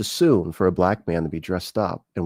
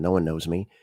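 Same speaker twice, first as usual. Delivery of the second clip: ordinary talk, nothing marked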